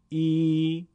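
ای held long and level. Shown as a demonstration. Teacher showing ya maddi beside kasra, prolonged. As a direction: stretch ای over two beats. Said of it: "دو برابر بکشیم".

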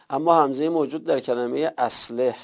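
ordinary talk

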